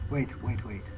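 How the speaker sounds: ordinary delivery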